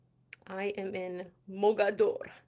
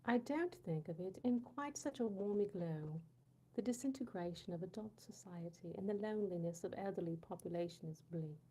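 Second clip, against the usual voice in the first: softer, smooth put-on British reading; British accent; 7 dB quieter than usual. Rereading the viewer's passage, soft British accent. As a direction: read it through in hushed, composed British. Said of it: "So many accents".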